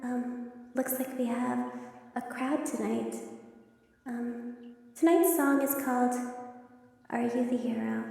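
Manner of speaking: a hint of a sweet stage idol greeting the crowd; lightly voiced pop idol. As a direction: greet the crowd like a shy young star. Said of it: "you hear her say".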